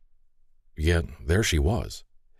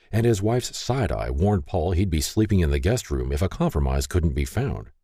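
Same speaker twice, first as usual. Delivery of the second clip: usual speaking voice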